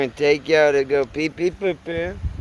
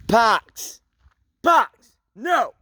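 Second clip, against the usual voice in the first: calling dog sharply; 8 semitones higher, 6 dB louder, much slower than usual. Real, not acted.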